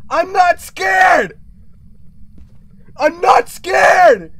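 slow, loud, defiant yell; defiant shout. Real, not acted. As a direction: bellow it slowly at the ghost. Yelling defiantly at the ghost.

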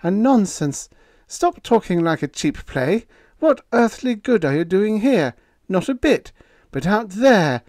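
high, loud, forceful wife's voice; a determined woman overruling her man; firm wife scolding her husband. A narrator's reading. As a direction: push him out the door to duty.

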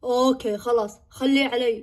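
low, eerie mother's voice; a stranger speaking through mom. Acted. Possessed mother asking who is crying, low and eerie.

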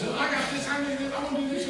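high, rushed eager volunteering; presumptuous. Acted. Peter volunteering before anyone else can, presumptuous and high. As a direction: jump in as if always first. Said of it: "he was presumptuous".

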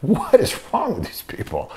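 high, disbelieving mock aside; incredulous. Telling how he reacted to the call, in an incredulous high voice. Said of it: "I'm like".

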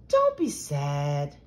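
slow, low, tender comfort; gentle consoling. A narrator's reading. Molly hugging her sad friend, slow low consoling.

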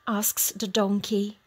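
usual speaking voice

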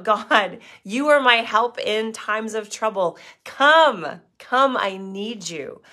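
loud, pleading cry that peaks on Come; calling out to God. Acted. Voicing David calling out.

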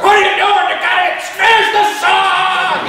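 high, loud mock shout of an old woman; grandma yelling. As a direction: scold the band like a fed-up grandma.

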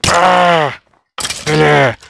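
loud yell urging the hit; loud frustrated yell. Real, not acted. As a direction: shout as you fight. Fighting archer. (grito frustrado)

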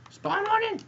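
very high, loud squeal; squealed demand. Real, not acted. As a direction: shriek it at the game impatiently. Urging the game, high squeal.

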